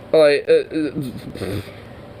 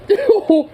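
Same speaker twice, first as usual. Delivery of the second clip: high shrieking burst of laughter; high squealing laugh; 3 dB louder than usual. Real, not acted. Cracking up at the clip, a high squealing laugh.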